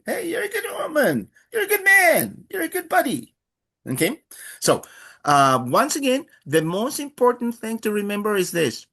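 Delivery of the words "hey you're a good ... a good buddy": high, quick, offhand compliments; breezy casual greeting. Shown as a demonstration. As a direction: rattle them off like small talk. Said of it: "rather than just as saying".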